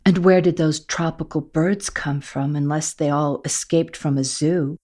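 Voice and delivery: a hint of a bewildered boy looking around; Matthew's voice. Matthew's voice in the story.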